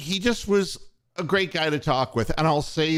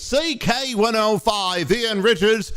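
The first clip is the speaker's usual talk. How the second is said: higher-pitched imitation of a British radio announcer; lightly imitated British on-air voice; British accent; 5 semitones higher than usual. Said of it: "in the British accent".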